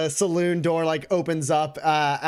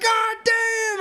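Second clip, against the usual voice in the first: very high shouted "God damn!"; a yelp of disbelief; stunned shout. Acted. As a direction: bartender drops the ice scoop in shock. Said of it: "he's like".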